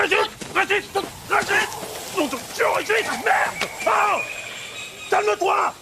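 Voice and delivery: high, urgent yelling; frantic restraining cries. Acted. Friend restrains Régis from killing, frantic yelling. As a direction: keep calling to break his rage.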